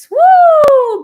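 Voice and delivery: sudden high loud whoop; joyful cathartic whoop; release whoop. Acted. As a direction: let it burst out with release. Releasing breath in meditation.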